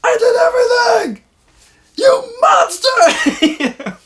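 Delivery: loud, high-pitched tearful protest; mock bawling. Boy accusing his prankster uncle, exaggerated bawling. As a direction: wail it like a wronged little kid. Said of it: "And I start bawling".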